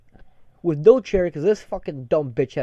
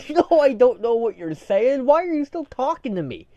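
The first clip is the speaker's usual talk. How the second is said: raised, higher, incredulous complaint; exasperated retort; 7 semitones higher, 4 dB louder than usual. Retold or imitated. Worker to co-worker he can't understand, exasperated. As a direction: plead in mock exasperation.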